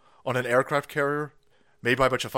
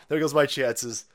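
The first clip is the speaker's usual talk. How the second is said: ordinary talk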